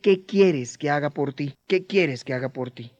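low, soft, intimate question; Jesus speaking personally. Retold or imitated. Jesus looks into your eyes and asks, low and soft. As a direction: ask it gently, eye to eye. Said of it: "esa frase en los labios de Jesús hoy diciéndote".

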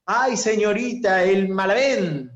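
put-on earnest kid calling out to teacher; little boy. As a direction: play an eager little boy answering. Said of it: "un niño dice".

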